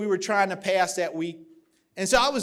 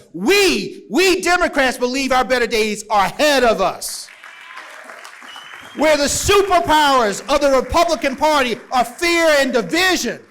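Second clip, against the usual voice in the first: loud, rousing rally preaching; preaching at full force; 5 semitones higher, 9 dB louder than usual. Acted. Party chair rallying the convention crowd, loud rousing preaching. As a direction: lift the whole hall, louder with every line.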